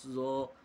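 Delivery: ज़ो with a thick, full-mouthed sound, not ज़ा; heavy ज़ो. Shown as a demonstration. Teacher showing the heavy sound of ज़ो. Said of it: "यानी मोटी आवाज़ के साथ ज़ो".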